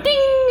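high, ringing imitated bell; elevator ding. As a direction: sing out a high elevator chime.

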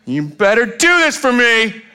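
high, drawn-out bossy whine; mock demand. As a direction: stretch it out as a pushy, entitled demand. Mock demand made to God.

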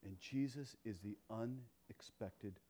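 quiet reverent close of the sermon; hushed reflection. Acted. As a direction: draw them in gently.

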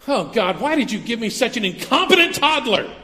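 hammed-up complaint to God about a toddler; mock parent complaining loudly; exasperated parent. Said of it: "looked at them and said".

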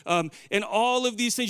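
ordinary talk

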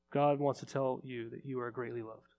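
soft, intimate reassurance; tender assurance. Real, not acted. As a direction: hush down and speak it straight to one person. Personal word to congregant.

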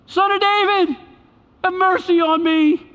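high-pitched pleading call across a distance; crying out. Acted. Blind man in the distance calling to Jesus, crying out. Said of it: "in the distance crying out".

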